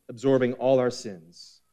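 ordinary talk

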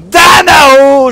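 a silly made-up Shout, bellowed; mock dragon shout. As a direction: stretch it out like a power word. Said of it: "Comme, euh, je sais pas".

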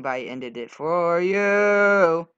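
teasing drawl. Acted. Teasing about turn.

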